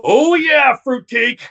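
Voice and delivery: loud, high wrestler catchphrase; Randy Savage-like exclamation, lightly imitated; Sid impression. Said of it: "Almost like a Randy Savage".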